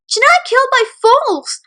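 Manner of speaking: angry yell. Acted. Bully's mom shouting at the principal, an angry yell. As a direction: yell it at the parents. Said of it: "the bully's mom yelled in anger".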